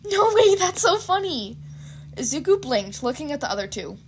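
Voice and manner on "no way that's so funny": high, loud squeal; girl squealing at a photo; excited squeal. Acted. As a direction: squeal with delight. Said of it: "a squeal".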